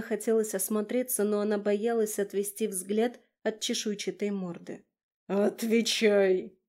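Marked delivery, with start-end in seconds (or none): 5.2-6.6 slow, louder barked command; dragon barking. Acted. The dragon demands an answer from Kira, barked command. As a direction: snap the order like an angry beast. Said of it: "рявкнул он".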